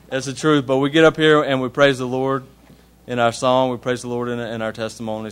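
ordinary talk, nothing marked

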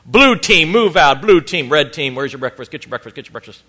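loud, barked drill sergeant orders. Retold or imitated. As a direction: bark it out like roll call, very loud at first. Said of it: "a drill sergeant".

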